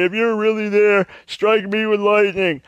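high put-on voice of a drunk daring God to strike; mock drunken dare. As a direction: sneer it up high like a drunk sailor in a storm. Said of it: "and they'll say".

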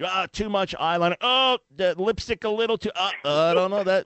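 high, fussy put-on voice of a nitpicking churchgoer; mocking makeup police. Host mocks church folk who police makeup, fussy high voice. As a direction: lampoon a scold fretting over lipstick.

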